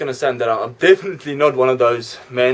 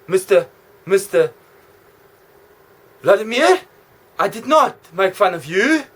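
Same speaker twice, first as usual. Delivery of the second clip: nervous protest; 6 semitones higher, 4 dB louder than usual. Acted.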